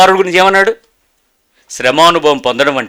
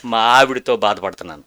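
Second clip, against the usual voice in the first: lower, softer, weary complaint of a burdened man; complaining church member; 6 semitones lower, 6 dB quieter than usual. Retold or imitated. A man tells the pastor his family troubles, lower and softer. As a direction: mildly mimic a worn-out man pouring out troubles. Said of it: "ఒక ఆయన అడుగుతాడు".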